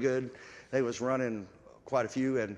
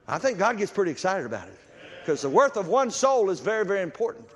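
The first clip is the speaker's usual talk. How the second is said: high, loud, building preacher's cry; full-force preaching; 9 semitones higher, 7 dB louder than usual. Acted. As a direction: drive the point home to the congregation.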